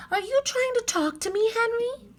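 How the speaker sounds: put-on high girlish voice; coaxing young girl, high; Annie's voice.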